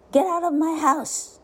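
high-pitched angry scolding; crying out. A narrator's reading. Wife to the messy duckling, crying out angrily. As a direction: order someone out, voice pitched high. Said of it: "cries the man's wife".

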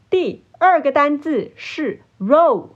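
ordinary talk, nothing marked

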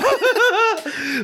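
high laughing burst that falls away; burst of laughter. Real, not acted. Cracking up over the ghost call story, laughing.

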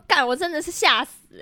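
usual speaking voice

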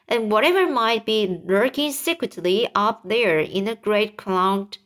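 ordinary delivery, nothing marked

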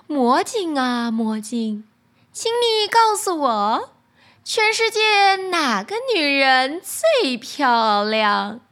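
slow, commanding queen asking; a vain queen summoning her mirror, slowly. Acted. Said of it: "问道".

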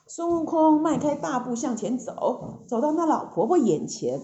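ordinary delivery

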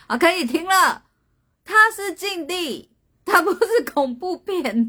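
loud, high-pitched mock outrage; exasperated, voice shooting up high; exasperated protest. Real, not acted. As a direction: protest loudly at the silly guesses. Begs joking viewers to stop guessing.

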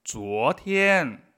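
slow, loud, careful Chinese examples; slow model sentences. Shown as a demonstration. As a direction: spell each example out slowly. Model sentences read slowly. (ejemplos leídos despacio y en voz alta)

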